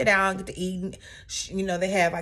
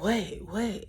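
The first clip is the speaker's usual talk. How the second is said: soft whispered "what?"; imitating a whispered gasp; 6 dB quieter than usual. Imitating the cast whispering in shock. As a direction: hush it like shocked gossip. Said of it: "And everybody was like".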